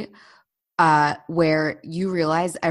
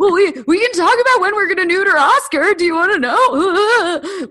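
much higher and louder, desperately chirpy; mock overeager chatter; 12 semitones higher, 9 dB louder than usual. Lonely dog owner cornering strangers, hammed-up high and loud. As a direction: play a lonely dog owner who won't let strangers leave.

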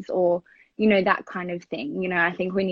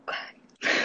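stunned aside; 7 dB quieter than usual. Retold or imitated. Stunned reply to brother.

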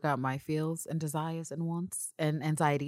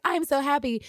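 high, bright mock-giddy I'm so happy; imitating Villanelle; 10 semitones higher, 4 dB louder than usual. Villanelle gushing to Constantine, mock-giddy. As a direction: gush like a giddy assassin. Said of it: "Just like".

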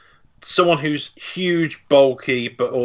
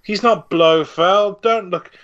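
mock denial; 6 semitones higher than usual. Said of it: "They kept going".

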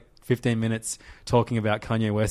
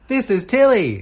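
high, raised voice for copying; loud demonstration; 10 semitones higher, 6 dB louder, slower than usual. Shown as a demonstration. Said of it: "just nice and loud".

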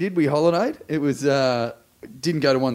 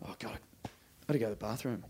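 whispered, mock confidential; hushed aside; 4 semitones lower, 17 dB quieter, faster than usual. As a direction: whisper it like a secret you shouldn't share. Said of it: "one who was dancing ... just goes".

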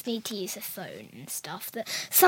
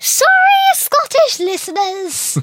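loud, high call to the listeners; mock apology shout; 13 semitones higher, 11 dB louder than usual.

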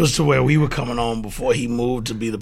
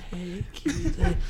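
put-on soft girl voice; 12 semitones higher, 5 dB quieter, much slower than usual. Girls' message at low volume.

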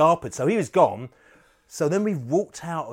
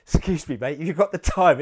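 menacing local fan; 6 semitones higher, 9 dB louder than usual. Retold or imitated. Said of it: "and then they go".

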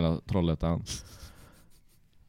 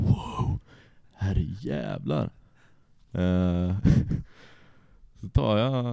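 ordinary talk, nothing marked

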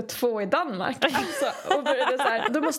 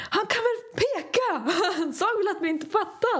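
higher mock-frustrated outburst; mock frustrated friend; 8 semitones higher than usual. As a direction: ham up an exasperated friend. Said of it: "Rafet blev skitfrustrerad".

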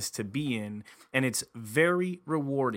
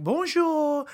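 French greeting; 12 semitones higher, 4 dB louder than usual. Retold or imitated. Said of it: "And the lady's like".

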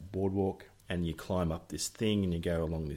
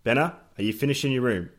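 loud, pointed passive-aggressive call; calling out sweetly but pointedly, like Bill; imitating stepdad Bill; 5 semitones higher, 9 dB louder than usual. Stepdad calling to kid left light on, loud passive-aggressive. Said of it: "This was Bill".

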